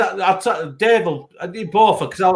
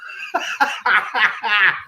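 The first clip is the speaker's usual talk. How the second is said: real chuckle; 3 semitones higher than usual. Real, not acted. Laughs at his own story.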